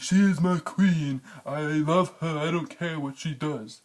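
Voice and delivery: high, slow lovesick mock Jon. Mocking Jon again.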